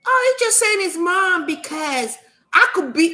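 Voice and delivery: high-pitched mock accusation; mocking the doubters. Mimicking people who sit there doubting her, mocking. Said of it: "People will sit there".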